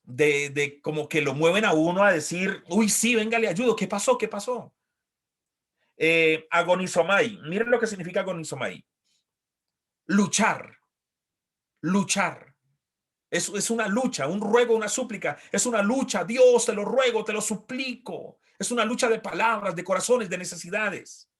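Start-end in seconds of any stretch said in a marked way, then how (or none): 2.7-4.7 high, eager offer to help; higher, keen helper's voice; eager concern. Retold or imitated. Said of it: "tienen ese tono de". 16.2-18.4 pleading prayer. Acted. Pleading to God in sermon.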